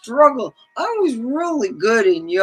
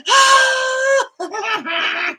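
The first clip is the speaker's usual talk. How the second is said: put-on much higher, louder shriek of a terrified child; imitated kid's scream; 6 dB louder than usual. Child terrified of a clown-faced woman, shrieking. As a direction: scream like a small kid seeing a clown.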